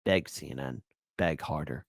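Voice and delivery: low, soft mocking command; quiet taunt. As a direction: murmur it with a smirk. Taunting clip of begging pundits.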